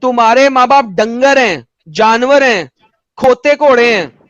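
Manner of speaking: slow, drawn-out pronouncement; declaimed quote. Acted. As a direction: spell it out word by word, slowly. Quoting scripture at opponent, emphatically.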